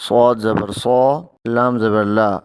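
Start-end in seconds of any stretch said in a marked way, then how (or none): none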